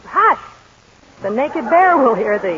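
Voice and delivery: mock lullaby from the poem; poem recital. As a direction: hush a child in verse. Recites Hiawatha lullaby lines.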